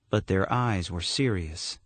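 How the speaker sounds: plain reading voice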